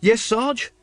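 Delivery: put-on high, eager young voice. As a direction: answer eagerly. Carrot answering eagerly.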